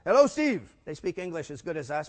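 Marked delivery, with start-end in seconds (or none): 0.1-0.6 put-on higher, louder German-accented greeting; hearty mock German accent on the phone. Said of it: "a German accent".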